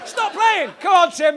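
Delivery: high, loud shout at the court; a big mock yell across the court; mock fan yell.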